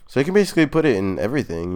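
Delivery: ordinary delivery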